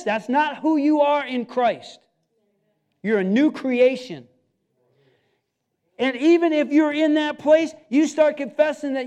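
loud, driving preacher's call; full-force preaching. Acted. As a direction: preach it out, building and rising. Builds the sermon to full force.

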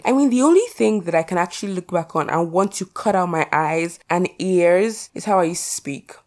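ordinary talk, nothing marked

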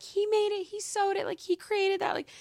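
acted high-pitched proud girlfriend burst; eager bragging. Girlfriend in the corner crediting her humble boyfriend, eagerly bragging.

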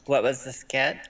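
usual speaking voice

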